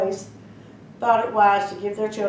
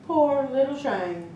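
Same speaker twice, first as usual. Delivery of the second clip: usual speaking voice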